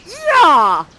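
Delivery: mock eager suitor shout; eager yes. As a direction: blurt it out thrilled. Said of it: "Ferdinand is like".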